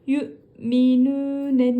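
yu'minune slow, yü sharply bounced; slow recitation. Shown as a demonstration. Teacher reciting yu'minune slowly. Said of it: "Niye yü dedik".

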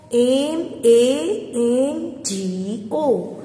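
letters spelled out one by one, very slowly; slow spelling. Shown as a demonstration. Teacher spelling mango slowly for her class. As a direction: space each letter for the children to write. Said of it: "spelling letters out".

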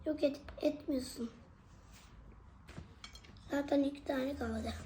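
chewing while talking; mouth full. Real, not acted. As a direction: speak through a mouthful. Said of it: "Yemek yiyorsun ya".

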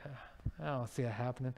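low, soft sceptical aside; doubtful aside. Retold or imitated. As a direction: voice the doubter quietly, pitch dropped. Listener doubts friend's prediction.

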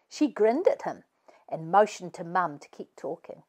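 usual speaking voice

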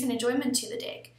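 normal talking voice

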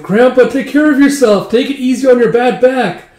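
high, loud youngster's farewell call; calling to grandpa. Retold or imitated. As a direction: call out a high, caring goodbye.

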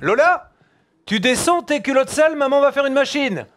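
put-on high-pitched call to a child; talking to daughter. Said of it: "on leur parle pas de la même façon".

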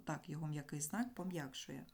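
usual speaking voice

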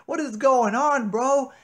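ordinary talk

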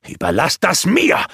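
curt, commanding sorcerer; brusque. A narrator's reading. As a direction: cut them off brusquely. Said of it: "beschied der Zauberer ihnen barsch".

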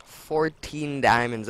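slow and proud. Real, not acted. Counting diamond haul proudly.

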